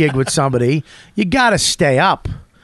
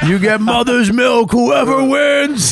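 higher, slow shouted ring call; announcer shout; 8 semitones higher, slower than usual. Pretend. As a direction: bellow the prize to the crowd. Mock announcer shouting the prize.

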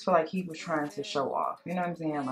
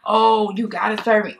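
mock entitled customer, louder; 3 semitones higher, 7 dB louder than usual. Storyteller imitating entitled folks demanding service, mocking. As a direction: lightly mimic a demanding customer. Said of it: "so entitled and TikTok".

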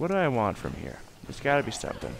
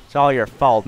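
ordinary talk

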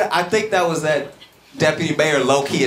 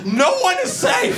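high mock shout. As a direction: yell it like a panicking official. Mocking the mayor's panic.

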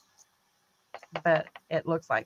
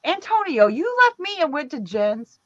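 higher, sing-song mock scolding; 8 semitones higher than usual. Scolding Antonio for leaving her for Jen's, playful high pitch. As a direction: tease the dog in a high voice.

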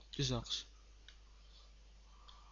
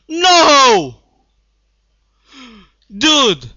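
loud high protesting shouts; outraged yelling; 5 semitones higher, 7 dB louder, slower than usual. Real, not acted. Gamer killed by TNT cannon, loud outraged yelling. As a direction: yell at the screen in disbelief.

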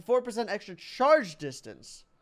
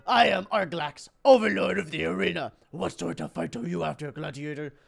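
arena overlord; 3 semitones lower, 3 dB louder than usual. Acted. Arena boss greets a gladiator, grand overlord voice. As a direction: announce yourself as a grand overlord.

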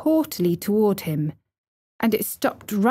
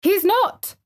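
put-on very high girlish cry of protest; high, indignant near-shout; fierce indignation; 12 semitones higher than usual. A narrator's reading. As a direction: leap to his defence indignantly. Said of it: "almost shouting up the wall in fierce indignation".

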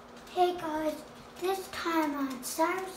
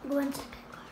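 normal talking voice

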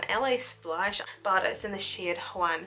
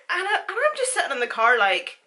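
laughing; 7 semitones higher, 7 dB louder, faster than usual. Real, not acted. Laughing while retelling.

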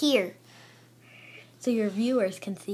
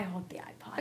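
whispering; 5 semitones lower, 16 dB quieter than usual. Real, not acted. Whispered aside.

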